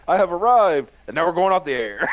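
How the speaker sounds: loud hammed-up proclamation; mock announcement. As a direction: boom it out like a grand entrance. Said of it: "the Holy Ninjas is like".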